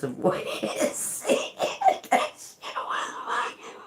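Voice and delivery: soft, voiceless laughing speech; dissolving into a real laugh. Real, not acted. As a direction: lose the words in quiet laughter. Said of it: "it's even got me laughing right now".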